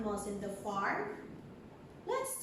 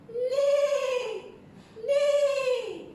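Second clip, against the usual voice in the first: high, drawn-out neigh like a horse; whinny the word out long and very high; 4 dB louder than usual. Pretend. Teacher voicing a horse for young learners, high drawn-out neigh. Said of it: "horse says".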